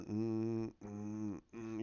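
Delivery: low, sustained ominous hum; drawn-out menacing hums; hummed theme music. Retold or imitated. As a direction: hum a villain's entrance theme. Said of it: "you're hearing his music".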